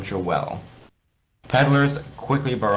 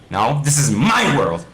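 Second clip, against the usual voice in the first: higher, louder indignant retort; arguing; 6 semitones higher, 5 dB louder than usual. Acted. Steve arguing with Alex over the world, indignant. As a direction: insist it's yours, offended. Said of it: "Steve argues with Alex".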